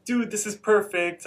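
lightly voiced thrilled kid; a touch of excitement; excited. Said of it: "just kinda get excited".

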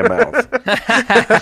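squeaky high, slow mock voice; silly high voice. Host joking about teeth, squeaky high. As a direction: squeak it out absurdly.

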